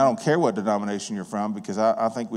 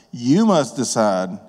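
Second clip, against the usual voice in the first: slow, deliberate challenge, a touch louder; slow, weighty; 3 dB louder, much slower than usual. Acted. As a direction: lay each word down slowly. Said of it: "preacher challenges the congregation".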